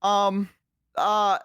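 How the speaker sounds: high, hesitant acted panic; panicking. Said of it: "and so I'm panicking".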